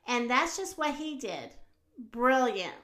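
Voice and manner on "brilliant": plain reading voice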